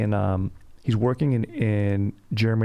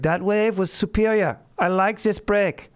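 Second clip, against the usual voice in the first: high, formal German-Aussie surfer bro; hammed-up German Australian surfer, pitched up; German Australian dude voice; 11 semitones higher than usual. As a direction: stiff German diction with surfer slang. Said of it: "It's my Australian German dude".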